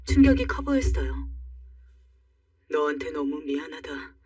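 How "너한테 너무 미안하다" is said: low, soft voiced man, subdued; a quiet, low-pitched apology in a man's role; apologetic boyfriend. Boyfriend apologizes to her over his mother, low and subdued.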